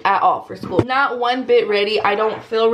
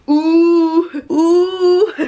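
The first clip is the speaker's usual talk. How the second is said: squeal; 6 semitones higher, 6 dB louder than usual. Real, not acted. Squealing over outfit.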